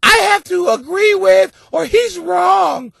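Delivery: shrill high mock woman's shout. Mocking a woman in the audience, high mock shout. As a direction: shriek it as a caricature of a nagging woman. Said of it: "a woman's sitting there going".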